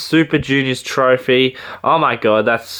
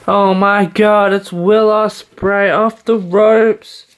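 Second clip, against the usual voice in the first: high, slow, hyped ringside announcer voice; wrestling commentator call; 7 semitones higher, 3 dB louder, slower than usual. Pretend. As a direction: call it like a big-match commentator spot.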